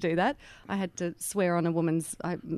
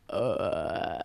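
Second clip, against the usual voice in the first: low, weak mock burp noise; a half-hearted fake burp grunt; bad fake burp; 12 semitones lower, 3 dB quieter than usual. Shown as a demonstration. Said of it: "and that's just bad".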